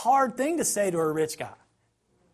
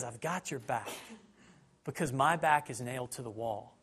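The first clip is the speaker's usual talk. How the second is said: soft, lower reassurance; tender Jesus; 6 semitones lower, 6 dB quieter than usual. Retold or imitated. Jesus reassuring sinners from the cross, soft and low. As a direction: hush it like a promise.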